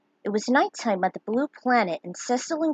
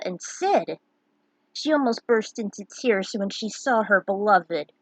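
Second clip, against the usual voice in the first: ordinary delivery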